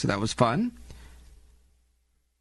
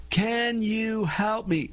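slow, high, over-enunciated request; painfully slow and higher-pitched; talking to a foreigner; 6 semitones higher, much slower than usual. Shown as a demonstration. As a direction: draw out every word as if to someone who knows no English. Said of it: "You speak slow".

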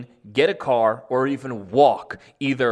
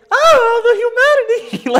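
very high, loud, theatrical wail; mock melodrama; 12 dB louder, slower than usual. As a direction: overplay the despair like a tragedy. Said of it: "mock dramatic lament".

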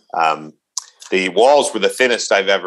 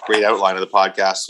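usual speaking voice

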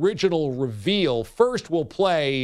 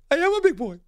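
high, proud silly voice; goofy high big boy; 13 semitones higher, faster than usual. Retold or imitated. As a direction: squeak it out like a proud kid. Performing [name]'s reply.

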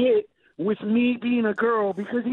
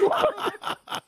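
high-pitched laughing outrage; stunned and cracking up; shocked disbelief; 12 semitones higher than usual. Retold or imitated. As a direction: squeak out indignant disbelief. Retold outrage at mom.

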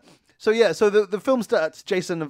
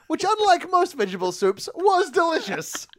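delighted; 11 semitones higher, 3 dB louder than usual. Real, not acted. Raving about soup.

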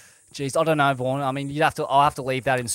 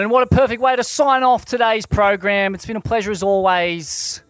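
loud, upbeat host outro; show sign-off; 8 semitones higher, 9 dB louder than usual. Acted. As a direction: wrap up the show brightly.

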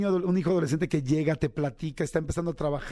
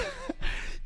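laugh; 6 dB quieter than usual. Real, not acted. Laughing at the joke poem.

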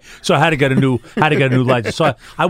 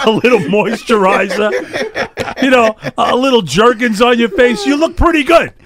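laughing; 8 semitones higher than usual. Real, not acted. Laughing about moisturizer.

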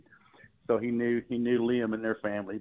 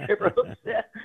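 laughing; 13 semitones higher than usual. Real, not acted. Laughing at the old guy's hook sets.

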